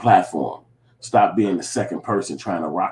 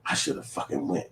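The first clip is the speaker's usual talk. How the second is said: whispered regret to yourself; inner thought whisper; 4 semitones lower, 13 dB quieter, slower than usual. Pretend. Thinking to yourself after missing the party, soft whisper. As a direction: breathe it like a private thought. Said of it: "you sticking in your brain like".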